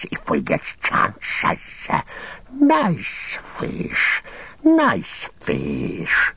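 slow, leaping from whisper to high squeal; drawn-out, hissy self-pitying creature talking to itself; Gollum's creature voice. Acted. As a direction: hiss and wail slowly, fretting over your treasure. Gollum muttering alone over fish.

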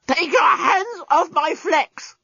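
high, slow strangled cry; a robot crying out while choked. A narrator's reading. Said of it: "choked the despot".

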